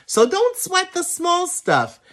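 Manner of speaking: high playful voice; playful advice. Real, not acted.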